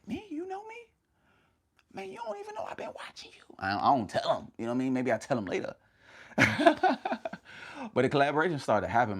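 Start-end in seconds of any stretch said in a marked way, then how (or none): 0.1-3.5 hushed, very high starstruck squeak; mock disbelief. Streamer acting out reply to admired collaborators, mock-starstruck whisper. 6.3-7.9 high burst of real laughter; laughing. Real, not acted. Streamer amused at own secret admiration, laughing.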